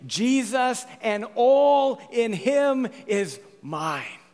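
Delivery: loud, high, long-held words; exultant hymn quotation. Acted. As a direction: declaim the hymn line slowly and boldly. Proclaims a quoted hymn line.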